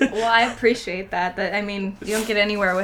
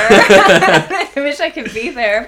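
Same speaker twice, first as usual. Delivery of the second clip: slow laughing wish; 2 semitones higher, 11 dB louder, much slower than usual. Real, not acted. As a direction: laugh through the longing. Laughing longing.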